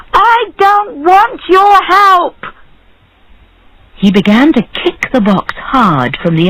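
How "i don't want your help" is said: high, slow, emphatic yell; angry shout. A narrator's reading. Faisal rejecting the barber's help, shouting angrily. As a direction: refuse at full pitch, each word drawn out. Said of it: "he shouted".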